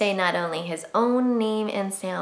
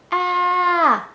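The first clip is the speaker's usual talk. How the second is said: sudden scared high shout of ah; 10 semitones higher, 6 dB louder than usual. Shown as a demonstration. Teacher demonstrating the scared letter sound, a sudden high yell. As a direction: jump back scared and yell ah. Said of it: "Kinda like you're scared".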